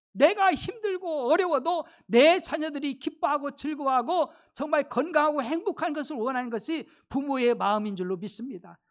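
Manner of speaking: ordinary delivery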